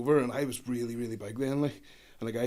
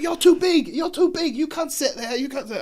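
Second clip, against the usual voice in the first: mocking drunk date, yelling; 9 dB louder than usual. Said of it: "And she's like".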